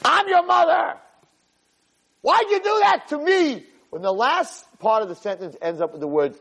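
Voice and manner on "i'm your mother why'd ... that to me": very high-pitched angry mom scream; screaming mother. Acted. Mother confronting her child, screaming. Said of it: "Not while you're screaming at me".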